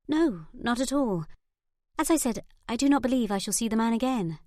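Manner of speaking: lightly voiced young lady; Lily's voice, lightly. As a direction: play it down, slightly too quick to reassure. Lily denies fear.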